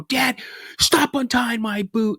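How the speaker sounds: put-on high, drawn-out kid's complaint; exasperated high-pitched mock yell; retold protest. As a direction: yell at dad to quit it, retold. Retold kid screaming at dad.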